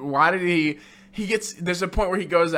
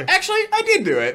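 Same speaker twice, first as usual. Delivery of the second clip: high, squeaky mock Bond confession; hammed-up high Bond voice; mock sheepish admission; faster than usual. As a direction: squeak it out like a caught kid. Bond casually admitting the crime.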